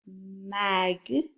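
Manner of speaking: "Mag" drawn out as one blended syllable. Shown as a demonstration. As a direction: blend "Mag" smoothly from its sounds. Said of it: "the sounds in the first syllable".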